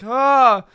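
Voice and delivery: loud high panicked yelp; sudden loud alarmed cry; freaking-out yelp. Retold or imitated. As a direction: yelp like an old man freaking out. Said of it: "freaking out".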